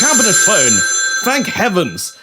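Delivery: excited relief. Acted. Prime Minister hearing the cabinet phone ring, excited relief. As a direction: cry out as if rescue has come. Phone rings during the quarantine.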